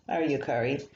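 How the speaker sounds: ordinary delivery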